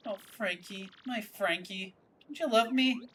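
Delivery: put-on a swooning girl begging for love; Francesca pleading. Daughter pleading with her boyfriend, high and soft.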